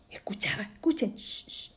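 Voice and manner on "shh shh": soft short shushes; hushing. Acted. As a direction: shush softly so all can listen. Child listening at the wall. (siseo suave para escuchar)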